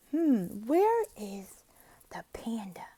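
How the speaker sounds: slow, breathy searching whisper; whispered wondering. Shown as a demonstration. Voicing teacher searching with child.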